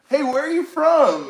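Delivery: eager friendly greeting. Acted. Greeting people from everywhere in heaven, eager hail. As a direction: call out with friendly curiosity.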